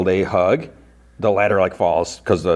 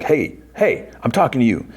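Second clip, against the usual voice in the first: retold calling after; 5 semitones higher than usual. Soldier following Robin down the hall, mildly imitated calling out. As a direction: imitate someone demanding her attention.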